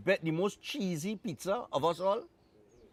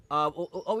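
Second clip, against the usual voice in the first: ordinary talk, nothing marked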